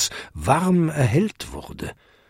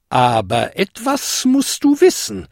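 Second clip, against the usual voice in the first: put-on measured old-lady caution; warning; 3 semitones higher than usual. A narrator's reading. Old lady cautioning goblin about the spell, warning. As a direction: caution him pointedly. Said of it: "warnte sie".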